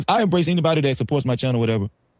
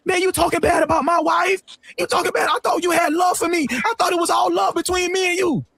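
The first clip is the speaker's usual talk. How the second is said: whiny complaint; 13 semitones higher than usual. Retold or imitated. Imitating Anton's hurt complaint.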